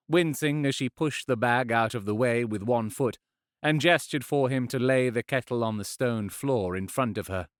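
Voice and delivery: ordinary delivery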